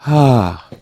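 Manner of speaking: relieved sigh. Acted. Imagined person whose nagging problem is fixed, relieved sigh. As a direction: let out a big "ah" of relief. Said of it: "you can say".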